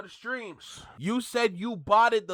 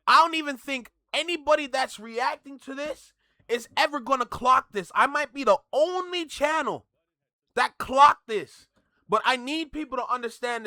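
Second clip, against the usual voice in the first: excited; 6 semitones higher, 4 dB louder than usual. Real, not acted. Excited about his own discovery.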